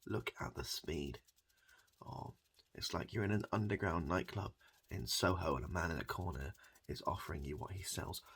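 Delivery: normal talking voice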